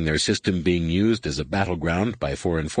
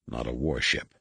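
low, soft grumble under the breath; dropped-voice aside; muttered; 7 semitones lower, 7 dB quieter than usual. A narrator's reading. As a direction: mutter it low, almost to yourself. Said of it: "Corrin muttered".

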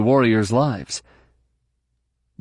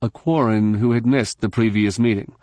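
plain reading voice

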